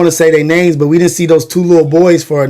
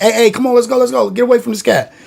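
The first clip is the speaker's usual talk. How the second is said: raised pitch, hurried urging to get away; urgent warning; 7 semitones higher, faster than usual. Retold or imitated. Boy urging his crew away from the preacher, urgent. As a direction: pull your friends away from the stranger.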